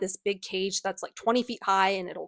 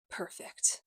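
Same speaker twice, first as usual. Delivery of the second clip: sinister breathy whisper; creepy whisper; 10 dB quieter than usual. Retold or imitated. Valentine hearing the girl's age, a creepy whisper. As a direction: hiss it like a pleased villain. Villain pleased by victim's age.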